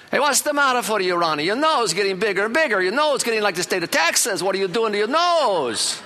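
high, loud mock Italian uncle teasing; loud Italian relatives. Italian relatives loudly mocking little Ronnie's nose, hammed-up. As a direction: tease the boy like a yelling Italian relative. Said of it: "Italians are very outspoken".

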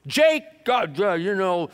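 loud, high calls across the field to the animals; farmer Adam hollering. Acted. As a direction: holler at the dog and the cow from the tractor.

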